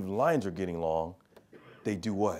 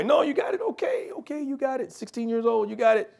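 much higher, mock brushoff; mocking the teen; 11 semitones higher than usual. Dad mimicking his daughter waving off his advice, high mock voice. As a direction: pitch it way up like a dismissive kid.

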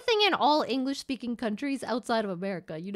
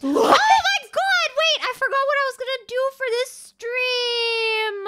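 high, loud, drawn-out cry; panicked realization; 10 semitones higher, 6 dB louder, slower than usual. Real, not acted. Streamer forgot the plan for the stream, panicked wail. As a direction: wail in sudden alarm.

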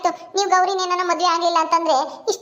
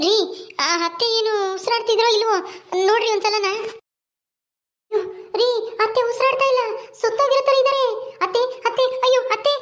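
higher, tearful panic as she calls out; panicked crying; 4 semitones higher than usual. Acted. Daughter-in-law finds her mother-in-law not breathing, panicked crying. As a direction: cry out in rising alarm over the still body.

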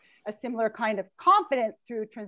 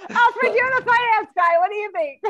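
laughing; 11 semitones higher, 7 dB louder than usual. Real, not acted. Laughing handoff, very high.

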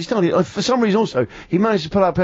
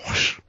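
[name] whoosh; 6 dB quieter than usual. Shown as a demonstration. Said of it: "The first one was".

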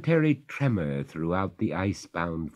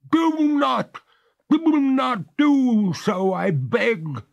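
very high, louder, slow begging ghost; crying out ghost; 8 dB louder, slower than usual. A narrator's reading. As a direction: cry out in high pleading. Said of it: "cried the ghost".

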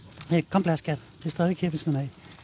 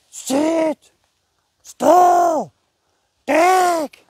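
high, loud barked dog commands, one word at a time; shouted commands; 10 semitones higher, 8 dB louder, much slower than usual. Retold or imitated. Trainer mocking handlers who shout commands at their dog, loud and high. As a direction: bark each command like a strict drill trainer. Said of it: "Altså i det toneleje".

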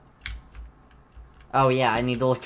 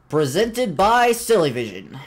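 announcer voice; 5 semitones higher, 8 dB louder, slower than usual. Pretend. Reads cartoon title card.

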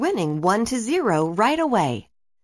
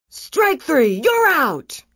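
referee call; 7 semitones higher, 4 dB louder, slower than usual. A narrator's reading. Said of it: "the referee said".

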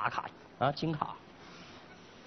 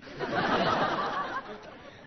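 long voiceless laugh. Acted. Salesman answering a friend's threat, laughing. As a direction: let out a long, breathy laugh.

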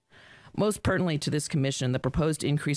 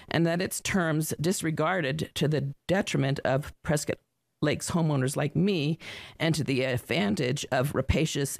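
normal talking voice

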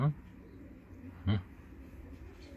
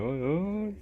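normal talking voice

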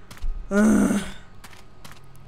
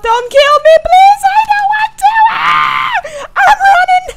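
1 panicked screaming; 9 dB louder than usual. Real, not acted. Chased by monster, screaming.

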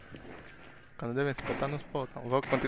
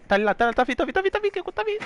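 rising panicked callout, higher and higher; panicking gamer; faster than usual. Real, not acted. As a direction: warn the team in rising panic. Enemy approaching during match.

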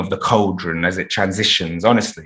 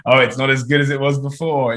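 lightly voiced nostalgic grumbler, pitched up; higher-pitched mild mock whinge; mock complaining; 4 semitones higher than usual. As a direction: imitate a moaner pining for the past. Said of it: "People love to look at the period of history".

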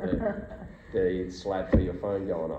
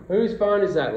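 higher, louder demand across the set; accusing call-out; 9 semitones higher, 7 dB louder than usual. Retold or imitated. Crew hearing a phone ring mid-take, higher and louder call-out. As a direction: call it out to find the culprit. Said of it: "we're like".

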